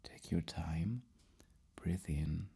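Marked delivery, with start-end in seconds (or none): none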